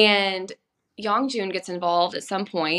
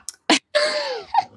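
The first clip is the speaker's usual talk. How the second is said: high squealing laugh; 4 dB louder than usual. Real, not acted. Laughing along with her co-host. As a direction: bursts out laughing.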